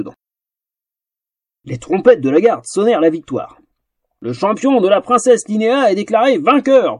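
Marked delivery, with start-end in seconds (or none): 4.2-7.0 formal proclamation. Acted. Captain proclaims the princess's champion winner, ceremonially. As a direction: proclaim it to the whole crowd. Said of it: "proclama le capitaine".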